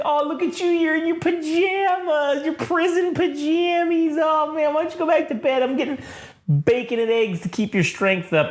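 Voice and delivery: doting woman. Retold or imitated. Woman fusses over man in pajamas, imitated doting. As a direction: put on a fussing, high-voiced woman. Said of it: "And she's like".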